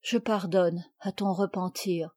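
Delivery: soft, low forgiveness; quiet, kindly mother; gentle. Acted. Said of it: "avec douceur".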